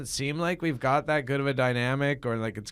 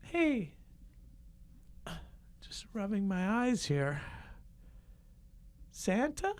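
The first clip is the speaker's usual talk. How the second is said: put-on kid voice; 11 semitones higher, 8 dB quieter, slower than usual. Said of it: "kid scene with Santa".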